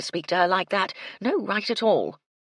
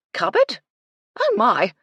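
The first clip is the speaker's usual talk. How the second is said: loud alarmed "Cupboard?", pitched up; startled; 7 semitones higher, 10 dB louder than usual. A narrator's reading. Startled housekeeper's outburst.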